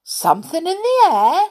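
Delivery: high, loud puzzled echo; mishearing saucepan man. A narrator's reading. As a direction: echo what you misheard, pitched right up.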